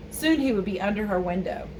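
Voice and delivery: usual speaking voice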